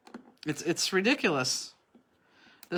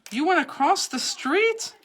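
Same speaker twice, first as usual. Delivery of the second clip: higher, slow disbelieving question; surprised; 8 semitones higher, slower than usual. Real, not acted. Friend asking about the dog walk, slow and surprised. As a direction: stretch it out in disbelief.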